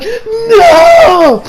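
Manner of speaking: very high, loud, long wail; anguished mock scream. Viewer reacting to the episode, hammed-up anguished scream. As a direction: scream it in over-the-top despair. Acting out his horrified reaction.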